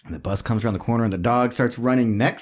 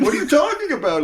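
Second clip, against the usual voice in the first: much higher, puzzled, laughing; clueless driver. Retold or imitated. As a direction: play the confused driver. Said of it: "He's like".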